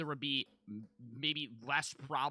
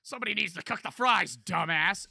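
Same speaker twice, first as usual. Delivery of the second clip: loud, higher mocking echo; mocking quote; 6 semitones higher, 8 dB louder than usual. Mocking the influencer's rant, loud mimicry. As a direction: throw his line back at him loud.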